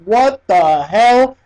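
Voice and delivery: slow, stretched what the hell; slowly dragged-out baffled exclamation; drawn-out disbelief. Retold or imitated. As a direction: let it hang in stunned slow motion. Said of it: "I'm like".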